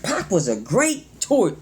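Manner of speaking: slow, emphatic start that trips up; fumbling the words. Real, not acted. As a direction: stumble on the last word and break off. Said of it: "Sometimes I fumble on my words".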